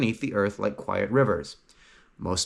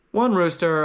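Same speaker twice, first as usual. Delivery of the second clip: ordinary delivery, nothing marked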